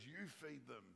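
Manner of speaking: a hint of sass, soft and pointed; sassy Jesus. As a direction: toss it back at them, mildly cheeky. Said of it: "I love it when Jesus gets sassy".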